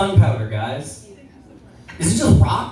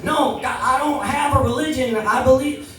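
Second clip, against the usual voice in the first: pitched up, insisting to the doctor; mild defensive plea to doctor; earnest higher protest; 7 semitones higher than usual. Retold or imitated. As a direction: plead your case a little high. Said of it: "I'm like".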